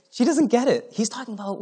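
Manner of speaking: high-pitched, through laughter; laughing. Real, not acted. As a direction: say it while breaking into a laugh. Laughing at her missing the point.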